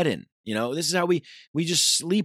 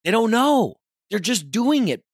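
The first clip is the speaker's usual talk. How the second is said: loud, high, fed-up outburst; exasperated rant; 8 semitones higher, 6 dB louder than usual. Real, not acted. Venting about people on autopilot, loud and exasperated. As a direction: throw it out in disbelief.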